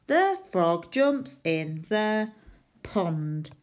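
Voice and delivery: slow, careful read-back. Shown as a demonstration. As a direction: drag each word as you write. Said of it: "reading back while writing".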